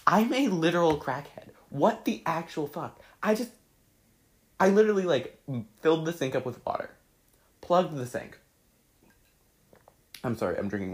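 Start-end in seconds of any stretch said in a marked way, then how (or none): 0.1-8.4 high, loud, fast-running rant; excited disbelief. Real, not acted. Recounting making sink coffee, worked up. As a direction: rattle it off in disbelief. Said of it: "excited retelling".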